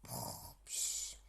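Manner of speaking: snore, then puff out air; sleepy snore and sigh; snoring. A narrator's reading. Animals snoring.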